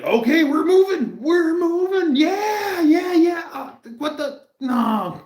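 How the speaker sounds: high excited yelps sliding into dismay; frantic hopeful then dismayed. Retold or imitated. Driver cheering the car on from the ditch, high and frantic, then dismayed. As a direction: yelp it high and hopeful, then crash into disbelief. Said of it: "It's like".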